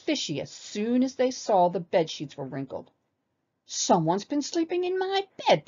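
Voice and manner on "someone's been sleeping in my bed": high-pitched outraged papa bear roar; big storybook outburst, pitched high. A narrator's reading. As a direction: roar it in indignation. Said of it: "Papa Bear roared".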